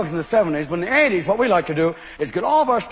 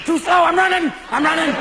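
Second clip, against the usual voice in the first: much higher, frantic; wired golfer; 8 semitones higher than usual. Acted. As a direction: bolt past the cart, frantic. Golfer ditching the golf cart.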